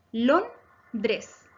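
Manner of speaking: "Londres" broken as Lon, pause, dres; teacher dividing "Londres" into its syllables. Shown as a demonstration. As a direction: split "Londres" into two separate beats. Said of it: "Se divide también en dos sílabas".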